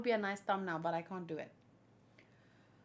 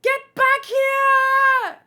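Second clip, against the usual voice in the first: much higher, much louder, slow drawn-out yell; 14 dB louder, much slower than usual. Acted. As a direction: bellow it after a runaway. Chasing after someone in game.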